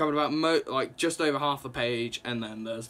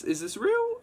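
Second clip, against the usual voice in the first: disbelief. Retold or imitated. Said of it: "it's like".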